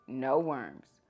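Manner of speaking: usual speaking voice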